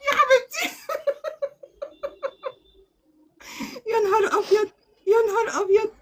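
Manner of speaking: high, moved exclamation; high touched cry. Real, not acted. Vlogger hearing Fifi sent her guests away, moved.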